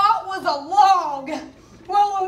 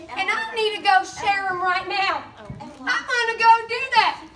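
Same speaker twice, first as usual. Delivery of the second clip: ordinary talk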